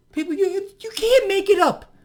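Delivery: high, loud incredulous cry; ranting viewers in mock outrage; exasperated. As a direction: pitch way up in disbelief.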